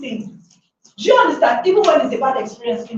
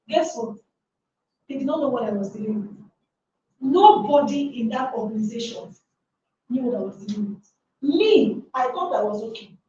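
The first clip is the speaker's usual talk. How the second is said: usual speaking voice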